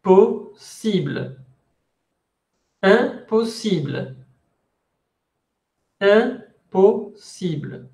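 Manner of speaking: "Imposible" slowly, opening on a nasal vowel; slow syllables, nasal first sound in "Imposible"; French pronunciation. Shown as a demonstration. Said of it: "No es imposible, no es un".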